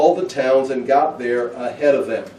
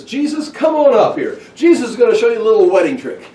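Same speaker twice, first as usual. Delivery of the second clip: high, loud mock show-off mother; proud mother; 8 semitones higher, 6 dB louder than usual. Mary calling Jesus up at the wedding, hammed-up showing off. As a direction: announce the son's trick to everyone. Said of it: "and Mary says".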